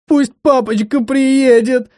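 high, slow drawn-out wail. Acted. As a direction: wail like a hysterical girl. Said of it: "завыла по новому кругу".

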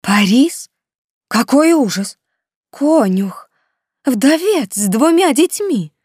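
high, loud, scandalised aunt; indignant outrage. Acted. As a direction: rail at her in shocked indignation. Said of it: "Хельга негодовала".

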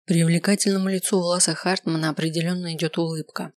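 ordinary delivery, nothing marked

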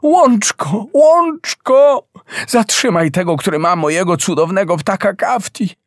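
crying out very high to the meadow for help; calling out. A narrator's reading. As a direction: plead to the meadow with a cry in the voice. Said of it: "wołała dalej Piękna".

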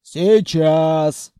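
loud, rough troll-like call; hoarse bass. A narrator's reading. Khalya answers from the side door, hoarse bass shout. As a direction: holler back in a husky bass. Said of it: "донёсся из боковой двери хриплый бас".

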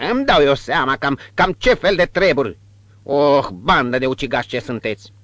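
high, loud accusing cry; scornful retort. Acted. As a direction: fling the insult at them. Innkeeper retort.

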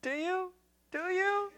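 high squeaky self-mockery; mock self-imitation. Pastor mocks his younger self calling in the mall, high-pitched. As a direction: parody your own desperate plea. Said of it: "Dumb [name], who's".

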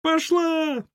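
slow, very high drawn-out shrieks; piercing screams to chase something off; shrill squealing. Acted. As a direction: squeal each command at the top of the voice. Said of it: "визжали из подвала".

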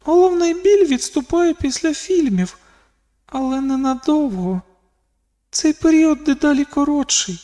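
високий відсторонений внутрішній голос; розмірковуй тонким, високим голосом; думки Каліші. A narrator's reading. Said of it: "подумала Каліша". (високий голос думок дівчини)

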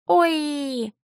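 high, sweet little moan; creature moan. Acted. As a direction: coo like a tiny creature. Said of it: "Сладкий стон Пушинки".

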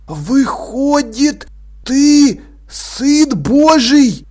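high, very slow hushed wonder; awed stage whisper. A narrator's reading. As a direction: breathe it out in astonished reverence. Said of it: "прошептал он".

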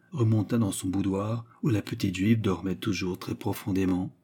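plain reading voice

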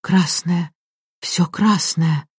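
quiet, drawn-out horror; hoarse, trembling. A narrator's reading. Maid beside Raoul staring at the red, trembling horror. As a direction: let the words out slowly, aghast. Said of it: "хриплый и дрожащий".

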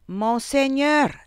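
slow, high shouted title; drawn-out correction yelled at a deaf man; shouted in his ear. A narrator's reading. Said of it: "gli gridò nell'orecchio".

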